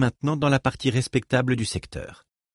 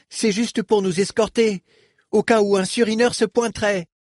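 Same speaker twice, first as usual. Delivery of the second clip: explication nerveuse; 11 semitones higher than usual. A narrator's reading. Reich s'explique vite.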